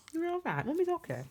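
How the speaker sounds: high, teary reassurance; calm the dog through tears; reassuring the dog. Real, not acted. Crying owner telling her dog she is okay, high and teary.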